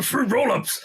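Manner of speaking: a hint of Seth Rogen; Seth Rogen laugh. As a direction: giggle it out like a stoner. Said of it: "Seth Rogen laugh".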